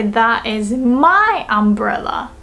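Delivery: MY drawn out and punched; stress on MY. Shown as a demonstration. Claiming one umbrella among many, stress on MY. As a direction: lean slowly on "my". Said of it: "So we wanna say".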